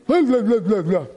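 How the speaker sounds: babbled blahs standing in for baffling text; blah-blah babble, like speaking in tongues; mock tongue gibberish. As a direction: imitate garbled reading as nonsense syllables. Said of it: "it all sound like a tongue".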